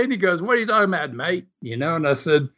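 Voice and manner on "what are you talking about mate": higher-pitched Aussie lifeguard reply; imitated Aussie accent. Aussie lifeguard puzzled by a tourist's question, imitated Aussie accent. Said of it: "that accent".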